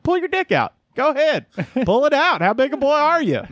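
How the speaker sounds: put-on high-pitched Southern accent, egging someone on. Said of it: "start off in a Southern accent".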